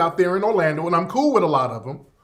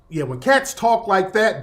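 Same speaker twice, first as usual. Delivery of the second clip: ordinary delivery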